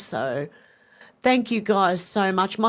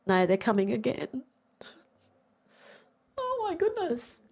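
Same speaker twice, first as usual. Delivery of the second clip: tearful, high and soft by the end; overcome, voice rising thin as tears come; real tears welling; 5 semitones higher, 5 dB quieter than usual. Real, not acted. Streamer moved to tears by viewers' donations, tearful. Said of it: "got tears coming out of my eyes".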